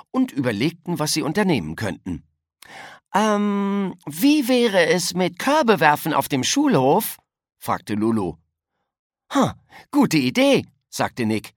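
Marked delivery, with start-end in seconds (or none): 3.1-7.2 high-pitched schoolgirl voice; higher, lively child character; eager child's suggestion. A narrator's reading. 9.3-10.6 put-on high, loud, keen child voice; excited schoolboy exclamation; enthusiastic agreement. A narrator's reading. Child welcomes a friend's idea.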